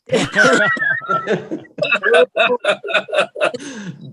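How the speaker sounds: long, high, hearty laugh. Real, not acted. Laughing at family story.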